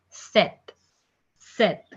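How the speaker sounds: "Set" as French seven with the p left out; silent P. Shown as a demonstration. Teacher repeats French seven, silent P. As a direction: go straight from the vowel to t in "Set". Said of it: "P will be silent here".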